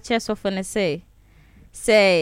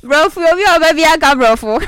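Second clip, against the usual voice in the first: excited laughing; 5 semitones higher, 4 dB louder than usual. Real, not acted. Excited greeting, laughing with host.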